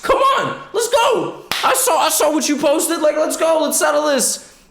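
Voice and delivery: very high, strained mock callout; squeaky mock taunting; viral-fight challenger. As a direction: yell up at a house for a fight, hammed up. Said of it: "these viral fight videos".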